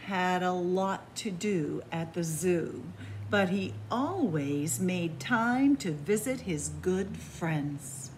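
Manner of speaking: gentle, lively bedtime storytelling; cozy storybook narration; reading to children. A narrator's reading. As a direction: read it like a picture book to little ones. Reads a picture book aloud.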